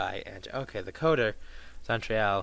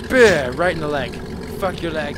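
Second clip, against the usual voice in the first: loud, higher gloating cry; triumphant shout; 3 semitones higher, 8 dB louder than usual. Real, not acted. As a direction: crow over the hit. Enemy hit in leg.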